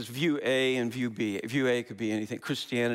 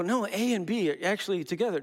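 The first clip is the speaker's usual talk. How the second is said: higher, insistent know-it-all; mock objector; 8 semitones higher than usual. People insisting A and B are together, higher voice. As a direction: correct them with pitched-up certainty. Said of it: "people who go".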